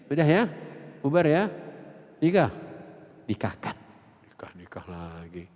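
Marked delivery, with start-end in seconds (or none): 3.3-3.8 breathy hushed Nikahkan, no voice; whispered nikahkan. Real, not acted. Preacher whispering advice to the congregation, hushed whisper. 4.3-5.5 whispered aside, voiceless; conspiratorial whisper. Real, not acted. Preacher joking about remarrying, whispered aside.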